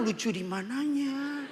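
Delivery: slow, soft, sceptical drawl; deadpan mock bafflement, stretched out; unimpressed man. Men doubting the child is cute, slow unimpressed question.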